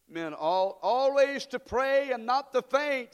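loud, slow congregation recitation; recited together. Shown as a demonstration. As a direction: lead the crowd through it slowly. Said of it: "Say it with me".

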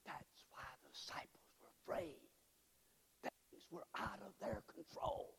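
hushed unvoiced whisper; a breathy pulpit whisper. Real, not acted. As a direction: lean in and whisper the secret. Hushed point about losing control.

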